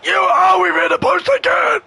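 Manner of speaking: loud, high yell as the shot hits the post; frustrated shout. Real, not acted. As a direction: scream it in disbelief.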